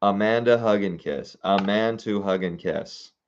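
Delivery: normal talking voice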